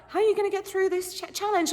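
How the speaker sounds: high, bouncy mock interviewer question; a giddy, sleep-deprived mock question; dumb hyped question. Camera-hungry contestant asking another contestant, giddy and high. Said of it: "I was saying the dumbest stuff".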